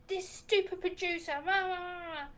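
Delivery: hammed-up outburst with a cry in it; imitating angry sister. Imitating her sister's outburst at the radio, mock angry.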